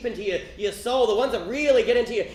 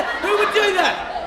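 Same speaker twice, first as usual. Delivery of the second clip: outraged disbelief; 8 semitones higher, much slower than usual. Real, not acted. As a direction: stretch it out appalled. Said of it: "outraged punchline".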